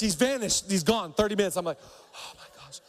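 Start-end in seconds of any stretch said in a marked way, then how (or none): 1.8-2.8 whispered, slow and unvoiced; scared whisper. Pastor relieved Heath left, frightened whisper. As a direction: breathe it out in awe and fear. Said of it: "I was so afraid".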